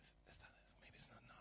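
fast, breathless whisper of doubt. Retold or imitated. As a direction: rush it under your breath, unsure. Father doubting what he sees.

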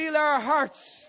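slow, high, loud cry; fervent slow prayer shout. Acted. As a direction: stretch out each word, shouting. Call and response prayer.

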